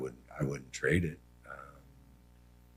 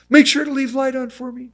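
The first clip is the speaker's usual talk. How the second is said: put-on childlike mother; 6 dB louder than usual.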